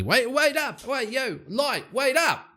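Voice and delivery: very high, far-carrying call; calling after him. Acted. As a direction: shout to stop him leaving. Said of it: "turns to him and goes".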